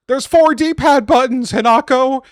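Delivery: high, loud mock-outraged yell; exasperated shouting. Scolding Hinako about the menu controls, exasperated shouting. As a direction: shout it up high in comic disbelief. Yelling at game character about buttons.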